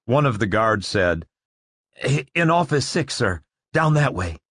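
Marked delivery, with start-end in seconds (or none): 2.0-4.4 high, stammering frightened guard; nervous stammer. Acted. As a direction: gulp and stumble over words.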